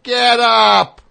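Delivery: screaming. Retold or imitated. Wife screaming at the drunk stranger, full scream. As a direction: scream it right in his face. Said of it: "She screams in his face".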